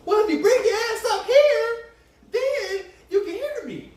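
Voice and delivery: high, loud, teasing shout from the other room; mock shout from afar. The daughter shouting back at her dad from another room, high and laughing. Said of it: "and I be like".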